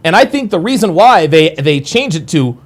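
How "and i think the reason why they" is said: normal talking voice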